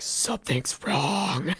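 slow, drawn-out mock distress; mock alarm. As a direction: stretch out WRONG in pretend suffering. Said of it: "being pretty calm for, like, somebody who's like".

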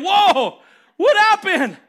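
loud, high startled "whoa"; startled reaction. Acted. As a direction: act out being jumped out of nowhere, loudly. Acting out Jacob's startled reaction to wrestling.

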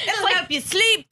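slow, high Irish granny lilt; hammed-up Irish accent; Irish-accented grandmother. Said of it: "an Irish accent".

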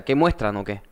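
ordinary delivery

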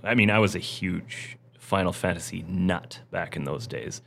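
ordinary talk

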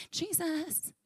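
soft, high, tentative little call; half-hearted mock searching call, kept small; timid calling. Preacher mocks a half-hearted seeker calling Jesus, timid calling. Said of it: "Not, "Let's see what happens".

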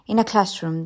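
ordinary delivery

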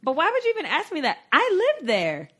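high, incredulous retort; indignant retelling. Retold or imitated. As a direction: reenact being offended, pitch shooting up. Retold indignant reply to friend.

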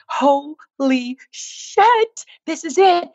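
slow, rising amazement; the moment it all clicks, voice climbing high; overwhelmed revelation. Retold or imitated. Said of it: "I'm, like, laughing and I'm crying".

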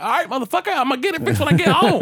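high, rushed exasperation; fed-up retort. Retold or imitated. Driver snapping at cop about headlight, high fed-up retort. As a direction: fire it back high and fast.

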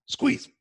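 sharp gasp, strained squeeze; a sharp gasp, then squeeze out the word. Shown as a demonstration. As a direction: gasp and bear down. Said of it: "One more at the top".

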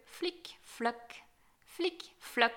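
short separated sound effects, mostly soft at first; imitated wet footsteps. A narrator's reading. Something wet climbing the marble stairs, imitated plopping steps.

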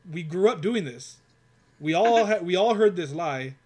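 pushing back hard at the engineer; heated rant. Retold or imitated. Retelling an argument with the engineer, a loud heated rant. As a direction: voice rising loud in the retold rant.

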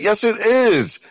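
imitated high-pitched insistence; retold, emphatic contradiction; insistent comeback. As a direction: push back, pitch jumping up. Retelling his insistent comeback.

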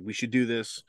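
ordinary delivery

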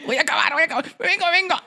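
hammed-up high moaning voice; mock climax voice. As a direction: ham up a climax, pitched high. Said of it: "cuando eyaculas es así".

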